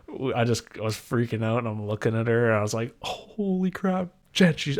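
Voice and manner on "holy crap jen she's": higher, slow and amazed; awestruck exclamation. Retold or imitated. Dad stunned by the baby, telling Jen, higher and slow. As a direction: drag out holy crap in awe.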